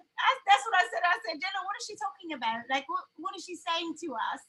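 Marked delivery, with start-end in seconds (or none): 1.4-4.4 softer, puzzled fake British aside; British accent. Mother asking her child about the clerk, British accent. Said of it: "said in your British accent".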